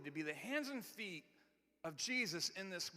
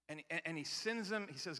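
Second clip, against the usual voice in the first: ordinary talk, nothing marked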